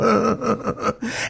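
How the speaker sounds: smug little giggle, imitated; mock giggle. Sam mocking his hurt friend on the phone, smug imitated giggle. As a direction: giggle at him like he's paranoid. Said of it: "And then he would giggle like that".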